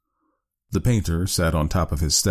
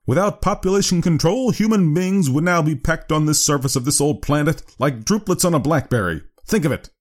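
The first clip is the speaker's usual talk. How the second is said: high, emphatic lecturing doctor; severe doctor; 11 semitones higher, 3 dB louder than usual. Acted. Doctor warning father about overcrowding, severe high lecture. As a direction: press the warning home in a raised voice.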